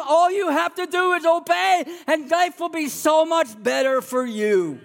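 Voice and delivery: high, sing-song coaxing; God coaxing his child like a parent; God coaxing his children, high and sing-song. Retold or imitated. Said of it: "And God says that too".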